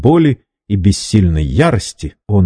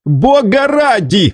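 high startled cry, slow; slower than usual. A narrator's reading. Captive cries out to his captor in alarm. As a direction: blurt it out in shock. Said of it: "вскрикнул".

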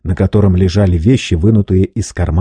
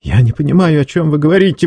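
exclaim; 8 semitones higher, faster than usual. A narrator's reading. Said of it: "воскликнул Варбек".

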